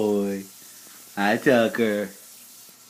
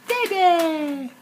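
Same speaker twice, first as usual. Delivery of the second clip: high coaxing call. Real, not acted. Coaxing baby.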